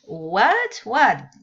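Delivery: what rising then falling loud; teacher showing English what tunes; varied what. Shown as a demonstration. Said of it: "you don't say".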